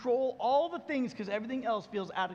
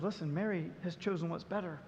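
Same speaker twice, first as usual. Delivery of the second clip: gentle God speaking; 4 semitones lower, 5 dB quieter than usual. Retold or imitated. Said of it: "And God says".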